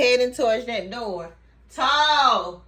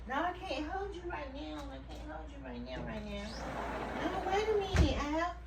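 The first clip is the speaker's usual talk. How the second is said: very soft, mostly high murmur; hushed pet talk; 5 semitones higher, 12 dB quieter than usual. Real, not acted. Owner tells Al she can't hold him now, soft pet talk. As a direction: gently put the pet off, very quietly. Softly refusing a pet wanting holding.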